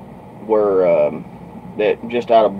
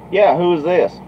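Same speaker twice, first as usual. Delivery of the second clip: higher-pitched questioning dad; imitated puzzled father; 9 semitones higher, 4 dB louder than usual. Father asking the strange caller who it is, imitated demand. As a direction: mimic a dad demanding who's calling.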